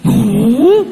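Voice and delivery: high, drawn-out innocent "no"; child's denial. Retold or imitated. Son answers father's accusation, high mock-innocent denial. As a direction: put on a little boy's wide-eyed denial. Child denying he marked bathroom.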